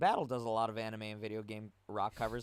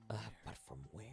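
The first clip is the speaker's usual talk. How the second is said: whisper; 5 semitones lower, 14 dB quieter, much faster than usual. Real, not acted. Hushed aside.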